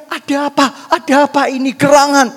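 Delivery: loud acted commotion; mock urgent crowd cry; alarmed onlookers. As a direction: call out like a bystander running to see. Onlookers running over in alarm.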